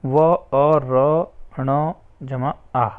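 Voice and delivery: each letter sounded out slowly, one by one; syllable by syllable, unhurried; slow spelling out. Shown as a demonstration. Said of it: "Warna".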